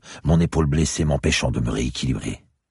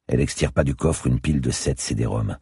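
ordinary delivery, nothing marked

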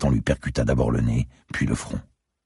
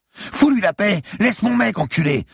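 high-pitched outraged yelling; frantic screaming; 4 dB louder than usual. A narrator's reading. Girl defending her man from the gunman, screaming. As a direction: scream at him to back off.

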